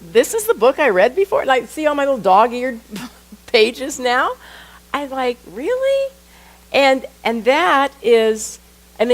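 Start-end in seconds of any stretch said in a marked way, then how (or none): 0.0-4.3 higher, louder mock bewilderment; incredulous, with a laugh creeping in; amused disbelief. Acts out disbelief at reread book. 5.5-6.1 very high questioning squeak of doubt; incredulous. Retold or imitated. Acts out own disbelieving reaction.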